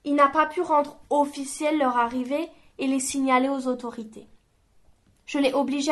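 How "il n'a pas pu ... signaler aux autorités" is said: ordinary delivery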